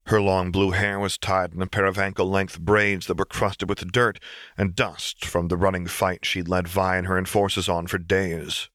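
plain reading voice

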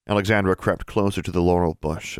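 plain reading voice

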